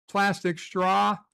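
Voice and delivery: ordinary talk, nothing marked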